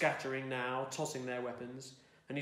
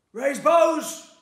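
much higher, full-throated roar; roared order; 13 semitones higher, 18 dB louder than usual. A narrator's reading. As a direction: bellow it across the line like a corporal. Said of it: "roared Corporal Grey".

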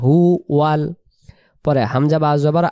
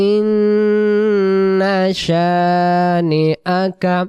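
Quran recitation; 3 semitones higher, much slower than usual. Shown as a demonstration. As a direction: chant the final verse, drawing out the vowels. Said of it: "প্রথম আয়াত".

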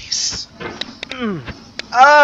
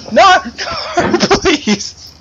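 laughing plea; 8 semitones higher, much slower than usual. Real, not acted. Pleading while laughing.

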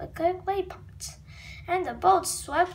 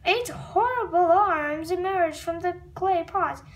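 usual speaking voice

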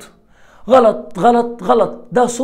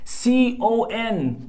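letters spelled out slowly; teacher dragging out each letter; slow spelling; 4 semitones higher, 4 dB quieter, much slower than usual. Shown as a demonstration. Teacher spelling B O N slowly for students. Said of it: "تتدرب على الصوتيات".

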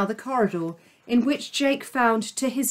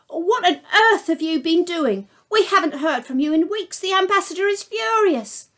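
scolding receptionist; 8 semitones higher than usual. Acted. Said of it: "said the voice at the other end".

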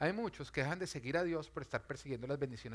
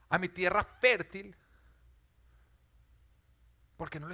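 builds louder and higher to a ringing shout; preaching at full force; 4 semitones higher, 7 dB louder than usual. Acted. Preacher to congregation about old deserts, building to a shout. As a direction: preach it, climbing until you cry out.

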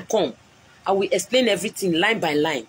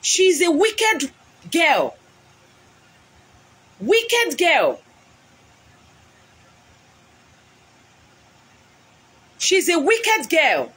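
furious denunciation; 7 semitones higher, much slower than usual. Real, not acted. Woman on live stream denouncing her rival as wicked. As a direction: spit out the insult.

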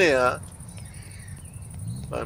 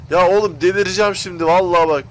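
exasperated; 5 semitones higher, 5 dB louder than usual. Real, not acted. Engine still dead.

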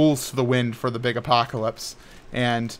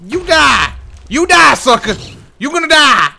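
high, loud taunting yell at the monster; furious shout; 10 semitones higher, 8 dB louder than usual. Real, not acted. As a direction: scream threats at the enemy, all out. Yelling at a mob attacking him.

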